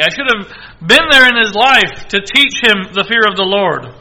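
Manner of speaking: loud, high impassioned sermon voice; preacher urging the congregation; fiery preaching. Acted. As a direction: drive the point home from the pulpit. Preaching at full force.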